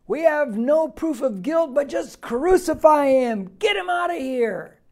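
loud, high, drawn-out crowd shout; mob demanding. Acted. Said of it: "That's what they're saying".

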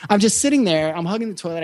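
ordinary talk, nothing marked

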